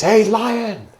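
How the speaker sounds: high, loud call across a field; shouting. Acted. Lion King spots a lion among sheep, shouting. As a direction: call out loudly to get his attention. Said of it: "he shouts and he says".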